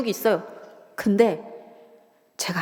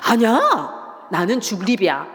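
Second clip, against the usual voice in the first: drawn-out placating reply; 4 dB louder, slower than usual. Retold or imitated. Placating father during his rant.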